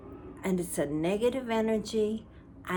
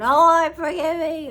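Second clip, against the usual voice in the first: higher and much louder, a hammed-up wail; mock grumbling plea; 7 semitones higher, 10 dB louder than usual. Mocking someone groveling on their knees, hammed-up loud wail. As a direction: overact a groveling penitent. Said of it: "get down on your knees and grumble".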